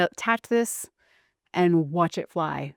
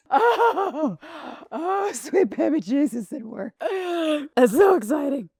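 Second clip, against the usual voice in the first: much higher, slow ecstatic gasps; giddy excitement; 7 semitones higher, slower than usual. Real, not acted. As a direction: gush like the launch just made your day. Celebrating the launch.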